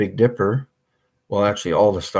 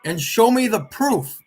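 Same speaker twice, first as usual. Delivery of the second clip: loud demanding; 14 semitones higher, 6 dB louder, slower than usual. Real, not acted. Demanding proof from Bob.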